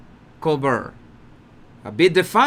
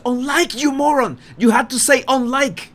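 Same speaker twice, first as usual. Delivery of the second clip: higher, mock-annoyed jab; exasperated scolding; 7 semitones higher than usual. Colbert's inner voice mocking Harry, higher and exasperated. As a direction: scold him like a dim pupil, pitched up.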